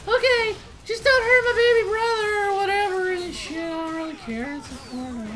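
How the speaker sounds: put-on high squeaky little-girl voice, very high; six-year-old girl voice. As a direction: squeak it out like a bored little kid. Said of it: "So the six-year-old is like".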